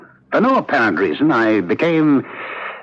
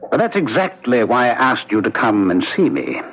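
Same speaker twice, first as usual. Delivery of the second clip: ordinary talk, nothing marked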